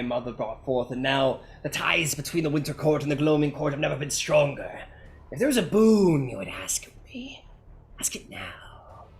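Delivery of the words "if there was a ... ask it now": whispered royal offer. Acted. Frost King offering the party a boon, whispered.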